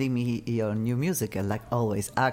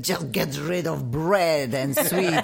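slow, louder mock advice with a laugh; lightly voiced friend, laughing; quoting friend; 3 semitones higher, 4 dB louder, slower than usual. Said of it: "she said".

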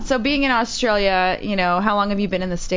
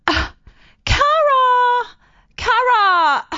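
put-on wailing Australian girl, very high and loud; Phoebe, Australian accent; 4 dB louder, much slower than usual. Girly Australian friend walking in pained after a wax, hammed-up wail. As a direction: cry out the name in a hammed-up Aussie whine. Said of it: "I will do my Australian accent for you".

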